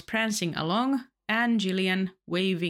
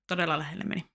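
ordinary talk, nothing marked

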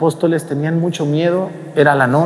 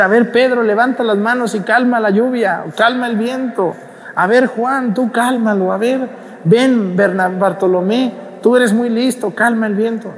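bossy apostle; 9 semitones higher than usual. Retold or imitated. Apostles ordering each other around.

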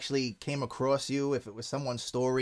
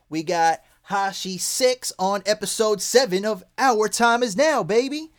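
rising, louder hype call; hype shout-out; 6 semitones higher, 4 dB louder than usual. Real, not acted. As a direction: build to the show name. Podcast intro hype.

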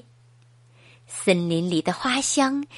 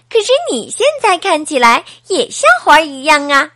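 louder, quick and bright; cheerful reply; 3 semitones higher, 6 dB louder, faster than usual. Acted. 艾弗大声快快地安慰哈利亚特. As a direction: cheer your friend up.

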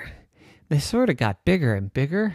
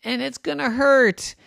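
put-on worried child; 14 semitones higher than usual. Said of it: "she thought".

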